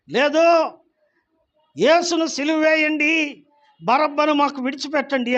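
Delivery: crowd's shout. Acted. The crowd demanding Pilate crucify Jesus, shouted. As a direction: shout it like an angry mob. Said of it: "అన్నాడు".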